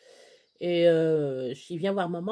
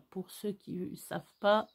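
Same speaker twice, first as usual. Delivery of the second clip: ordinary talk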